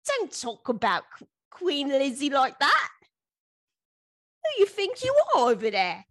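high, sniffy mock-British put-down; mock British scold. British listeners scolding her for Queen jokes, huffy mock voice. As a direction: play an offended Brit scolding the American. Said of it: "And be like".

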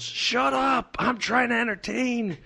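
imitating a pushy comic snapping at makeup girls; mock yelling comic. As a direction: ham up an arrogant warm-up guy yelling. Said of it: "he would yell at the makeup girls".

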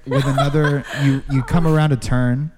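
laughing through the words. Real, not acted. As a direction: giggle while you narrate. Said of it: "GM laughing while narrating".

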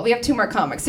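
normal talking voice

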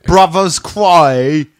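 loud, drawn-out mangled Boston accent; bad Boston accent. Shown as a demonstration. Joking with friends about a film title, botched Boston accent. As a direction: stretch it in hammed-up Boston. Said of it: "Uh, that was my Boston accent".

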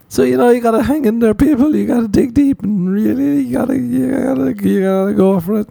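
rally the crowd in a squeaky fake-inspirational voice; mock pep talk. Comic urging LA people to hang in there, hammed-up high pep talk.

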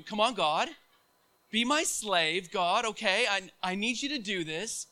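put-on sulky child turning into a loud tantrum at God; whiny angry demand to God. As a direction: start grumbling, build to a loud tantrum at God. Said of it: "and you're like".

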